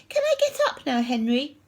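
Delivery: put-on high, small-boy voice; little brother. A narrator's reading. Peter lying still asking his brother, high little-boy voice. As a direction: ask it high and innocently.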